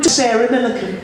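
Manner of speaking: high Geordie impression; Sarah Milliken. Shown as a demonstration. Comedian naming who he sounds like, high Geordie impression. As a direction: put on a poor, high-pitched Geordie impression. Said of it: "Sound nothing like Sarah Milliken".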